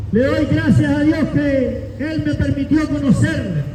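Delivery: ordinary talk, nothing marked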